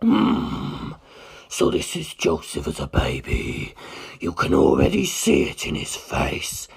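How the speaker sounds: a deep, unhurried ancient being; Carr's voice. Acted. Carr, ancient being, speaks.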